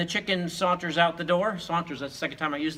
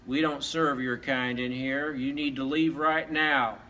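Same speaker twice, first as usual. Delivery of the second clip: slow, lower saloon-owner voice; slow, low order to get out; stern saloon owner; 4 semitones lower, slower than usual. Acted. Bartender throws the dog out, slow and stern.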